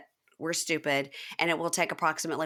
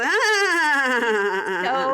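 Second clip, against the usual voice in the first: a high, loud squeamish "Ah"; mock recoil gasp; 6 semitones higher, 6 dB louder than usual. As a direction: yelp like you're shrinking away. He leans away and goes.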